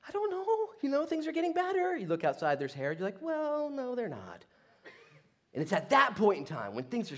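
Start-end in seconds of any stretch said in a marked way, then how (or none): 0.1-2.0 high-pitched, uncertain optimist; mock hopefulness. Voicing waiting people's false hope. 3.2-4.5 high voice sliding down; mock deflation. Same voice, hope deflating.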